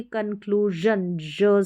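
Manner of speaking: कंक्लूजन slowly with voiced zhun, then ज alone. Shown as a demonstration. Teacher correcting the sh reading, कंक्लूजन with zh. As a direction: pick out the buzzing ज of कंक्लूजन. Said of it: "ज sound".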